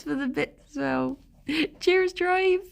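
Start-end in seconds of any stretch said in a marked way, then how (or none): 1.8-2.6 pitched-up Bristolian attempt; Bristolian accent. Shown as a demonstration. Streamer obliging a viewer, Bristolian accent. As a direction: have a go at Bristolian, higher. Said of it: "In your best Bristolian accent".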